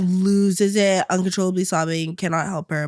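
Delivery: usual speaking voice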